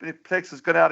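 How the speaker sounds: ordinary delivery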